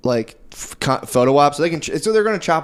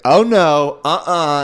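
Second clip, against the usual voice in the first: mock-indignant, louder retort; indignant refusal; 3 semitones higher, 5 dB louder, much slower than usual. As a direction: act out her flat-out refusal. Retelling a comic's indignant refusal.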